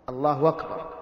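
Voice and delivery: "الله أكبر" as Allāhu wakbar; hamza turned to waw. Shown as a demonstration. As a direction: replace the glottal stop of "أكبر" with w. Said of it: "فيجعل الهمزة واوا". (نطق "الله أكبر" بواو مكان الهمزة)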